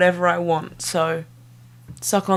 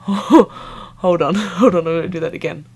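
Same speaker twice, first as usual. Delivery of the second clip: laughing; 6 dB louder than usual. Real, not acted. Laughing after the microphone mishap.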